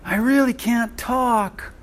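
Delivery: high, slow mock whine; whiny excuse. Preacher mimicking believers' excuse, high and slow. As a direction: act out a hopeless excuse, slowly. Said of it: "some of us say".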